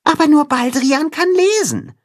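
high, squeaky mole voice; little mole. A narrator's reading. Mole reminds Timme who can read, squeaky. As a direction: pipe up with a reminder. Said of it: "erinnert ihn der Maulwurf".

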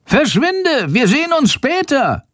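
high bat call. Acted. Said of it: "ruft Schandor".

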